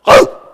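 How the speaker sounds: loud, abrupt dog bark; one explosive imitated snap; sudden jaw snap. Whip's jaws suddenly going at a puppy, loud bark. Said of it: "you have these jaws go".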